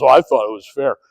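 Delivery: low, soft muttered confession; sheepish aside. Retold or imitated. Partner umpire admitting after the game, low and soft. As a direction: say it low, half to yourself. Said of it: "he goes".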